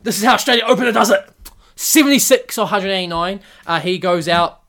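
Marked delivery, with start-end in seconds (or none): 0.0-1.3 loud, higher, fast mock boast; cocky opener impression. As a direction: brag like a cocky opener showing off. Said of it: "Marcus Harris like".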